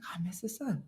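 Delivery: whispered self-reproach. Retold or imitated. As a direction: breathe it like a guilty aside. Mutters about ruined salad.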